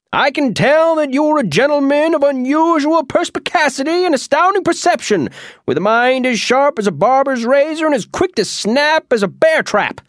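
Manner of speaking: Oz flattering. Acted. Wizard Oz buttering up the Munchkin, high flattering voice. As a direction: lay the flattery on thick and grand. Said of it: "Oz said".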